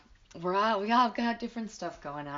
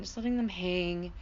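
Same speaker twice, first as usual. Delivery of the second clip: ordinary delivery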